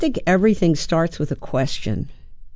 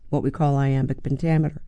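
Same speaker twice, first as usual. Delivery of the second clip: ordinary delivery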